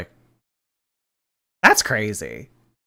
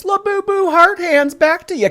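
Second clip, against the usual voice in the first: much higher and louder shout-out; squealing shout-out; 6 dB louder than usual. Acted. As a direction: squeal the thanks to the donor. Shouting back to a chat donor.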